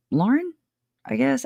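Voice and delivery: Lauren jumps up high, unsure; light it up high as the name comes back; sudden recall. Real, not acted. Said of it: "suddenly remembers the name".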